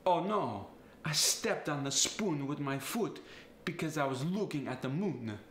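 Italian accent, "foot" and "looking" with oo vowels; dismayed Italian, "spoon", "foot", "moon" all on oo. Shown as a demonstration. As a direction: make "foot" rhyme with "moon", Italian style. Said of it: "get changed to the oo sound".

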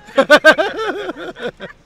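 loud, high burst of laughter; helpless laughter. Real, not acted. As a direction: crack up for real. Cracking up at the explanation.

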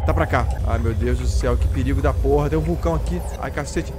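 ordinary talk

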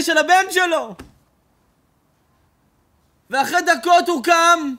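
high, loud, slow impassioned preaching; loud, high impassioned sermon climax; preaching at full force. Acted. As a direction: cry it out over the grave. Climactic preaching of collapse and crying.

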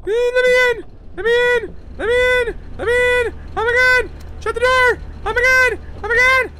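high, loud frantic screams; frantic screaming. Real, not acted. Gamer screams at the door while fleeing, high and loud. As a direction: scream to be let in.

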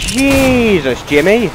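high, drawn-out first word; startled exclamation. Real, not acted. Gamer startled by an enemy, high exclamation. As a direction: exclaim it high, then drop.

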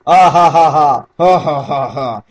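loud fake ha-ha laugh; hammed-up laughter, dying down; imagined hearty laughing. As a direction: laugh big and fake, then ease off. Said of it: "just imagine them ... just going".

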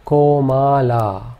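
Komala said very slowly, all vowels long; Komala, spacing each long syllable; slow demo word. Shown as a demonstration. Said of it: "Let's try one more example".

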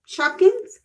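high loud surprised question. Real, not acted. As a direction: squeal the name in surprise. Surprised at toy name.

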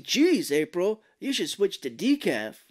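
Michelangelo. Retold or imitated. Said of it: "Michelangelo just comes out".

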